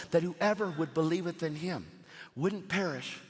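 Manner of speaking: usual speaking voice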